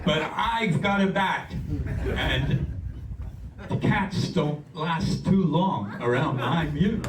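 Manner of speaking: mock tough-guy voice, high and loud, slow, half laughing; telling a friend about the bat by his door, in a hammed-up mobster voice; Sopranos gangster. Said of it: "could have been an actor on The Sopranos".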